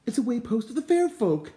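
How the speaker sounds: high, loud, eager exclamation; thrilled high-pitched cry; excited cry. A narrator's reading. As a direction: cry it out with delight. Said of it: "Islandly cried".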